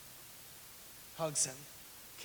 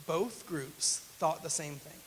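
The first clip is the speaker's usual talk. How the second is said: slow emphatic; slower than usual. Real, not acted. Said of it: "Don't miss this".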